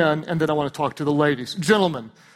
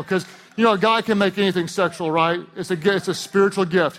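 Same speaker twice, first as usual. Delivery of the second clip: normal talking voice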